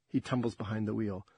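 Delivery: ordinary delivery, nothing marked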